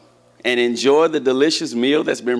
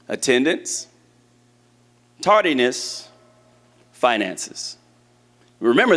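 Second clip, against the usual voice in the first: ordinary delivery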